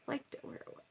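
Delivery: soft, breathy sulk; pouting. Real, not acted. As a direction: pout the words. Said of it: "I'm pouting now".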